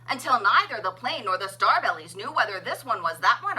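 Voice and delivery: plain reading voice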